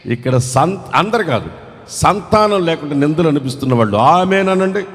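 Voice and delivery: ordinary talk, nothing marked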